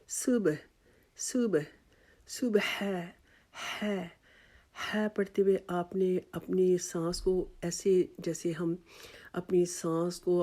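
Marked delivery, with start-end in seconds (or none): 0.1-4.1 صبح with a thin س and ہے breathed out like fogging a hand; light s and breathy h. Shown as a demonstration. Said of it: "سین جو ہے وہ باریک پڑھنا ہے". (باریک سین اور سانس والی ح)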